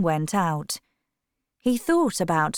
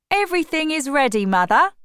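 higher, louder and fast; eager brother; 8 semitones higher, 5 dB louder, faster than usual. A narrator's reading. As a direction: rush it out to mother. Brother announces departure.

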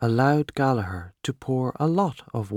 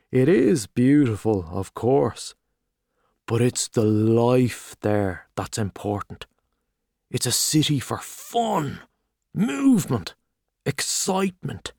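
hearty Gallagher. Acted. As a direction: boom out the welcome like a showy journalist. Gallagher praising Paris.

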